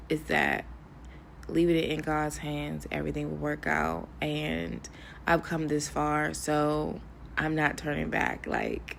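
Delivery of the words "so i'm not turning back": ordinary delivery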